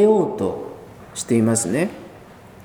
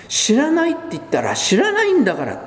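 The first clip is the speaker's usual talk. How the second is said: imitated shouting denial; 10 semitones higher than usual. Preacher imitating Peter angrily shouting his denial. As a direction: act out Peter's cornered outburst, half-hearted. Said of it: "というふうに怒鳴って声を張り上げて".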